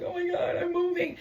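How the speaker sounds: high, whimpering retold outburst; imitated tearful cry. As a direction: act out crying to mom, pitch way up. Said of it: "I'm crying. I'm telling my mom".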